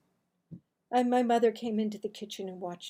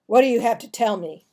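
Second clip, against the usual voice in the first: louder, fast stern father; 11 dB louder, faster than usual. Acted. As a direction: demand an answer, quick and hard. Angry father questions the boys.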